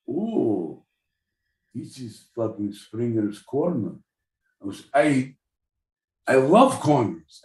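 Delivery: hammed-up Russian accent, broken English; jokey Russian accent. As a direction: play a bad comic Russian. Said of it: "the second question is for Russian".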